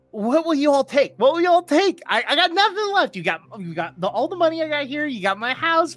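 high, loud, worked-up gambler; escalating, excitable plea; frantic bargaining. Acted. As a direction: push the table desperately to take a bet. Broke gambler begs for a stake.

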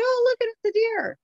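mocking a casual viewer who sees a deer; surprised onlooker. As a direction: squeal it like you spot a deer. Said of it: "it's like".

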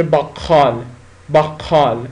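باقال with a throaty q, between k and kh; باقال said the way Turks say bakkal; Turkish-style baqal. Shown as a demonstration. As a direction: say باقال with the k pushed back toward خ.

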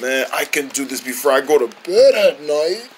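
normal talking voice

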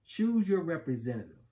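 usual speaking voice

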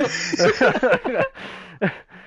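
big high burst of laughter; loud laugh. Real, not acted. Telling a friend the punchline, loud laughter.

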